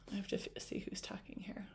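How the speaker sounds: fast, soft whisper; whispered aside. Real, not acted. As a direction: hurry it under your breath. Aside while reading.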